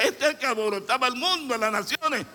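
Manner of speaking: rápido y agudo, con incredulidad; voz de gente sorprendida al reconocerlo; asombro. Acted. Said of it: "La gente va a quedar sorprendida".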